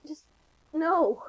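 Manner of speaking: usual speaking voice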